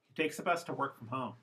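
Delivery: fast, "a" and "to" reduced to schwa; defocused English. Shown as a demonstration. Teacher repeating the defocused version for learners. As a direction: run "He takes a bus to work from home." together, weak words as schwa.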